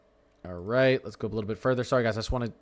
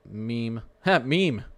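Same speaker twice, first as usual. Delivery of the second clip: "Meme" said with a laugh, then slowly repeated; amused at a name; much slower than usual. Real, not acted. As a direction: chuckle through "meme" as the name strikes him funny. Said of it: "that's funny".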